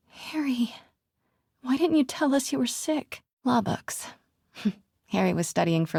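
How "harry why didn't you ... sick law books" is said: normal talking voice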